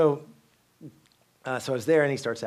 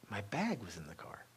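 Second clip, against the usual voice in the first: ordinary talk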